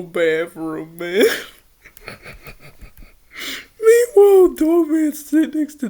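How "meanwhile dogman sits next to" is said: high, loud laugh on the word. Real, not acted. Reader still giggling as the story moves on, laughing. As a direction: laugh it out as you push on.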